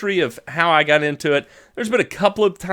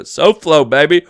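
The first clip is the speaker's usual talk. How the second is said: usual speaking voice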